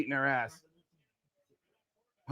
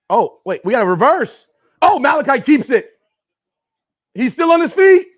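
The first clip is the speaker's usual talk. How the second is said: higher, louder as the play unfolds; excited play call; 5 semitones higher, 9 dB louder than usual. Real, not acted. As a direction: build to a loud, amazed call.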